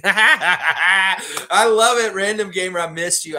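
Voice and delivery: laughing, high and loud, drawn out; laughing delight. Real, not acted. Laughing with delight at a fan's joke.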